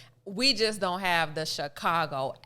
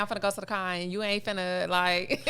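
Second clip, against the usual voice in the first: lightly voiced Chicago accent, R sounds dropped. Shown as a demonstration. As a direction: drop the Rs like a Chicago local. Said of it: "I need a sentence to say".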